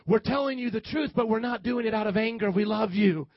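ordinary talk

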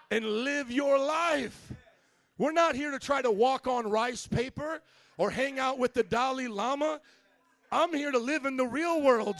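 loud, high, rousing pulpit shout; full-force preaching. Real, not acted. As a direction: build to a shouted declaration of faith.

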